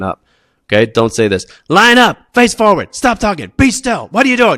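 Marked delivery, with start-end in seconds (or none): 1.7-4.6 much higher, louder frazzled barking of orders; frantic first-year teacher. Retold or imitated. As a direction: play a panicked new teacher losing the class. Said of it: "my impression of a first-year teacher".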